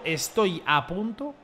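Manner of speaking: "estoy a punto" stretched out slowly; slow, drawn out. Real, not acted. Streamer on the brink of turning selfish, slow and drawn out. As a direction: draw out "estoy a punto", leaving it hanging. Repeats words slowly for effect.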